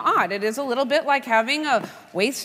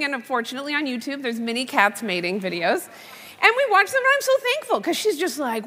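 ordinary talk, nothing marked

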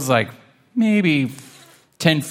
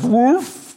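stuffed gulp noise; 11 semitones higher than usual. Acted. Stuffed Waffles gasps.

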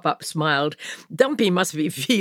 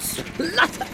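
high spluttered word breaking into coughs; spluttering cough; 13 semitones higher, 4 dB quieter than usual. A narrator's reading. Truck Dumpy coughs as the engine fails, high splutter. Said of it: "coughed Dumpy".

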